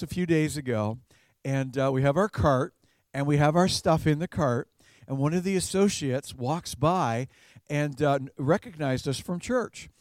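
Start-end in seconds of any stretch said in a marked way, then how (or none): none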